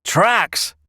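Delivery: high, loud rustic echo; incredulous shepherd. A narrator's reading. Shepherd reacting to Wilfred seeing tracks, incredulous. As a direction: throw the word back in disbelief.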